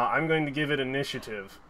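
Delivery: ordinary talk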